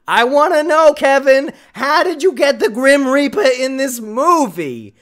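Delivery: usual speaking voice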